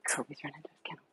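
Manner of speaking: soft whispered aside. Real, not acted. Owner whispering to viewers as Kobe runs off. Aside while handling dogs.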